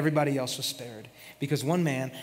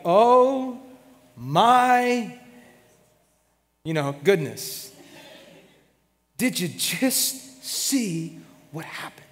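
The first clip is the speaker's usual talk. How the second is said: stunned gasp; 3 semitones higher, much slower than usual. Retold or imitated. Crowd stunned by swallowed rebels.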